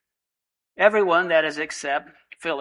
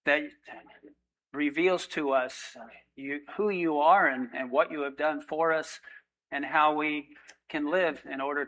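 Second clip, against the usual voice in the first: prayer; 4 dB quieter than usual. Real, not acted. Pastor closing in prayer before the sermon, quiet and earnest. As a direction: pray softly over the congregation.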